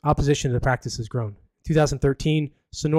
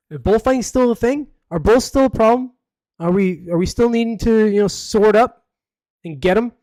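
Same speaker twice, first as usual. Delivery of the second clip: higher and louder disbelief; can't believe this still happens; incredulous; 8 semitones higher, 6 dB louder than usual. Real, not acted. Incredulous rant about bullfighting.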